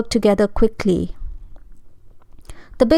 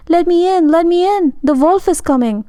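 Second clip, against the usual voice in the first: panicked pleading; 8 semitones higher than usual. A narrator's reading. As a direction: pound on the door in panic. Pig pounding on door fleeing.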